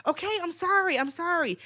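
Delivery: high, rushed pleading little sibling; kid's panicked apology. Retold or imitated. Younger sibling apologizing to brother, high rushed pleading. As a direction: beg fast like a child about to tell on you. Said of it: "or I would say".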